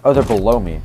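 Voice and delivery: ordinary talk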